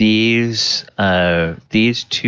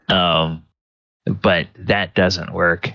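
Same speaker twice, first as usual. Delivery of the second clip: ordinary talk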